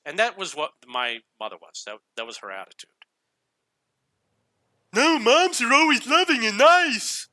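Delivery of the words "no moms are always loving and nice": high, slow, loud sarcastic sing-song; mock the idea with a sweet sneer. Sarcastic aside about mother.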